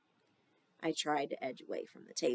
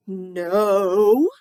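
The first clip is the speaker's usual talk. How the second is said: loud yell of panic; 3 semitones higher, 14 dB louder than usual. Acted. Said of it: "I yelled".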